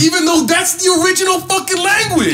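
loud, high, exasperated outburst; heated, high-pitched emphasis; worked-up indignation. Real, not acted. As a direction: shout the point out, really riled. Worked up about language being scorned.

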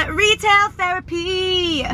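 dramatic slow announcement. Pretend.

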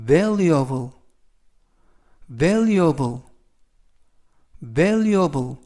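valuable said slowly, stress on the first syllable; model pronunciation. Shown as a demonstration. Teacher modelling valuable for students to repeat. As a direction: model valuable clearly, three times. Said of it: "이라고 발음하시면 되겠고요".

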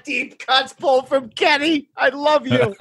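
high, slow words through big laughter; cracking up while praising a friend; real helpless, high-pitched laugh; delighted laughing. Real, not acted. Cracks up at obscure question.

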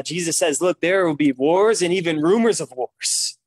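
ordinary delivery

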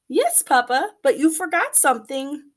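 put-on high eager child voice; chirpy little girl asking papa; little chicken voice.

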